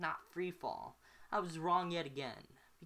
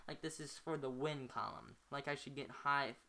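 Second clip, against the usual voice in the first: plain reading voice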